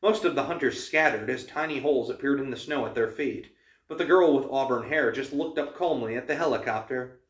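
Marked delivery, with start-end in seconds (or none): none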